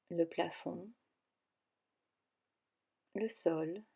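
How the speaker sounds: calm guided relaxation voice, with long pauses between phrases; guided meditation. Acted. Yoga teacher guiding listeners into yoga nidra, calm meditation voice.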